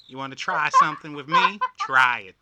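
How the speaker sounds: loud, fast mock dare; taunting a demon, daring it; imitated tough Texan daring; tough challenge. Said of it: "she's not afraid of nothing".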